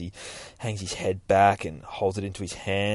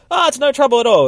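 pushy church lady, much higher and louder; loud, high-pitched housekeeper pressing lunch on the priest; insistent housekeeper; 11 dB louder, faster than usual. Retold or imitated. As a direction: insist sweetly but won't take no.

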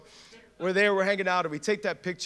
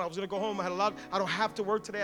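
ordinary talk

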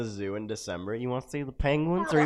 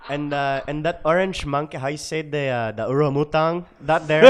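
put-on comic Filipino accent bit. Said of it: "you gotta do the Filipino accent".

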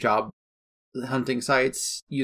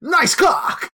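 excited shout; 4 dB louder than usual. Retold or imitated. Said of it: "TikTok video of just like".

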